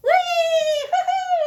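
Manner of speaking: high, loud party whoops into a laugh; gleeful whoops and laughter. Acted. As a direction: cheer like the party just started. Party begins, gleeful cries.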